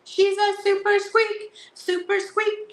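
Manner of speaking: high, squeaky little voice; super squeaky voice. Pretend. Reading the valentine card in a high squeaky voice. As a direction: squeak it out like a tiny toy. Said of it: "Super squeaky".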